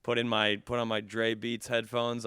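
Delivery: usual speaking voice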